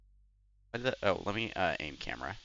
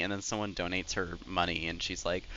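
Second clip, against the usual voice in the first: ordinary talk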